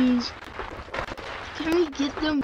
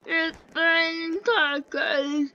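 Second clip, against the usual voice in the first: tearful crying; 6 semitones higher, slower than usual. Real, not acted. Kid watching tacos smashed, tearfully crying It's raining tacos. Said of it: "I'm actually kind of crying right now".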